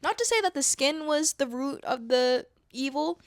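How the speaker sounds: ordinary talk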